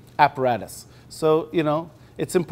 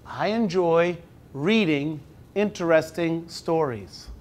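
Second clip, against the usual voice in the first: slow, measured picture-book reading; reading to children; slower than usual. Acted. Teacher reading the book aloud slowly to the class. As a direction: read it slowly to five-year-olds.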